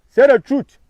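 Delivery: high, loud call for the truth; loud rally shout; shouted demand. Real, not acted. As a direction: belt it out like a rally cry.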